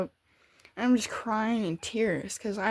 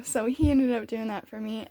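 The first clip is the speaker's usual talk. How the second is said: ordinary talk, nothing marked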